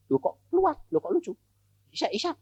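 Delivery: small, very high puzzled voice; baffled wonder. Retold or imitated. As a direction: marvel to yourself, high and soft. Soul baffled at leaving the body. (heran dan takjub, suara tinggi kecil)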